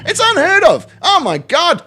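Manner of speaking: very high feigned disbelief; gasp-worthy scandal, played sarcastically high; mock shock. Streamer pretending lawful patriotism is shocking, in high mock disbelief.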